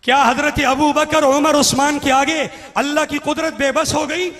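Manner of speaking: ringing, emphatic challenge; forceful rhetorical question. Real, not acted. Preacher repeats his question to the listeners, forceful challenge.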